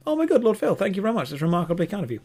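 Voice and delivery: high-pitched burst of thanks; surprised gratitude. Real, not acted. Host thanking Lord Phil mid-stream, genuinely surprised. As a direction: jump up high in real surprise, then settle a little. Reacting live to a viewer's gift.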